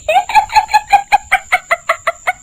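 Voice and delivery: high shrill cackling laugh; loud witch cackle. Acted. Witch reveals herself to the cart driver, cackling. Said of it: "जोर जोर से हंसती है".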